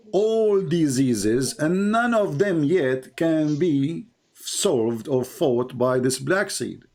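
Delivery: very slow, drawn-out words for emphasis; deliberate, drawn-out debating delivery; slow, emphatic. Real, not acted. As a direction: stretch out each phrase to drive the point home. Debater hammers home his objection.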